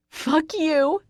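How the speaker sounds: high-pitched screamed curse. A narrator's reading. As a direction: scream it in his face. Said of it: "I scream".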